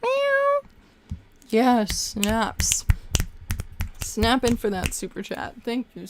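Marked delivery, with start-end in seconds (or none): 0.0-0.6 high, loud meowing cat. Retold or imitated. Voicing Stella the cat for the donor, high loud meows. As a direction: meow like the kitty. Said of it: "Stella's going".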